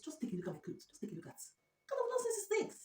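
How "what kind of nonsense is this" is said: high, slow crying wail; wailing complaint. Real, not acted. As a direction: drag it out in a wail. Wailing at ruined clothes.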